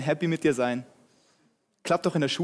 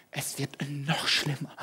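ominous forecaster; 4 semitones lower, 7 dB quieter, slower than usual. Acted. Weather service warns again.

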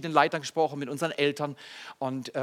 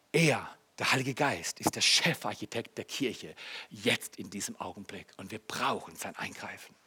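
low, very soft sermon voice; hushed solemn preaching; 9 semitones lower, 10 dB quieter than usual. Real, not acted. Preacher telling the church it needs the Holy Spirit, hushed and solemn. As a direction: drop right down into quiet reverence.

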